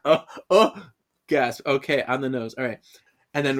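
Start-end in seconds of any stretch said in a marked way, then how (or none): none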